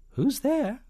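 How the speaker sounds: very high, loud spy voice; falsetto. A narrator's reading. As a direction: pipe up in falsetto. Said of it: "and a falsetto voice".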